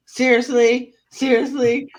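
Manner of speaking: ordinary talk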